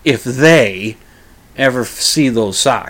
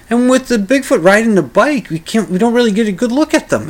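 high Bob voice; 7 semitones higher than usual. Retold or imitated. Bob voiced in retold story.

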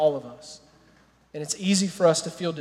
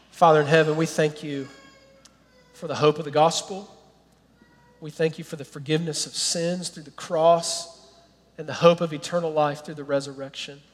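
prayer; 3 semitones lower, 6 dB quieter than usual. Acted. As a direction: pray it gently over the church. Pastor leads closing prayer.

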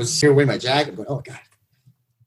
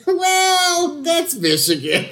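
ordinary talk

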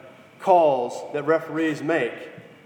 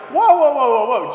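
high, loud protest of disbelief; alarmed whoa; 8 semitones higher, 8 dB louder than usual. Retold or imitated. Fan protesting the coin toss, shouting in disbelief. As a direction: stop everything, you can't believe it. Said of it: "And you're like".

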